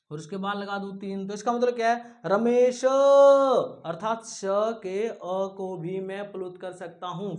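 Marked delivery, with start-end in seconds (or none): 2.2-4.4 रमेश with the श's अ stretched very long and loud; loud, slow call on the last syllable of रमेश; prolonged final vowel. Shown as a demonstration. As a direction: drag out the end of रमेश. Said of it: "फिर इसका उच्चारण होगा रमेश".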